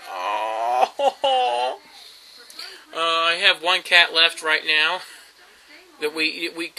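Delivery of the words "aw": long, melting "aww" over kittens; gushing aww. Retold or imitated. Cat lover reacting to tiny kittens, drawn-out gushing aww. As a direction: coo helplessly at something cute. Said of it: "It's like".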